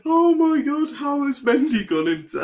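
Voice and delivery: high, loud disbelief breaking into laughter; squealed disbelief ending in a laugh; incredulous outburst. Real, not acted. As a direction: exclaim way up high, then crack up.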